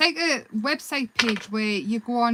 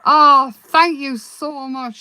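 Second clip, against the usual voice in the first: slow, louder heartfelt thanks; warm thanks; 4 semitones higher, 9 dB louder, much slower than usual. Real, not acted. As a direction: thank them warmly and slowly. Thanking a viewer in live chat.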